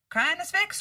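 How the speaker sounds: loud high reply; calling back. Acted. Justus answers his friends from behind the house, loud. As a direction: call it out brightly.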